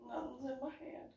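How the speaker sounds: high and very soft repeat; small-voiced denial. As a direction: act a sheepish, tiny protest of innocence. Repeats denial, much softer.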